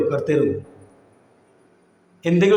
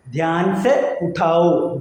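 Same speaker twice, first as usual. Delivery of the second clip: "ധ്യാൻ സെ ഉഠാവോ" drawn out, word by word; slow, careful "Dhyan സെ ഉഠാവോ" for learners; slow teaching demo; much slower than usual. Shown as a demonstration. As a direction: separate each word of "Dhyan സെ ഉഠാവോ" slowly. Teacher spells out phrase slowly.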